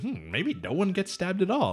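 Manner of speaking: high, slow, drawn-out pondering; hammed-up wishful thinking; mock musing. Voices fleeing character's hopeful thought.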